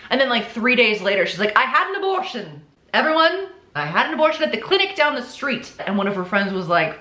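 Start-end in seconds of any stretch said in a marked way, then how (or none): 1.6-5.7 high-pitched, hammed-up proclamation; mockingly broadcast it in a raised pitch; mock announcement. Liar updating all her friends, mock announcement.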